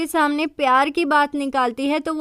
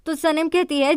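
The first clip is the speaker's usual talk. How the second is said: plain reading voice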